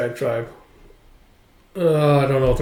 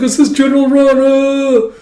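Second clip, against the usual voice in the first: high, loud shocked gasp; startled enemy; 11 semitones higher, 5 dB louder than usual. Acted. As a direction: gasp in disbelief.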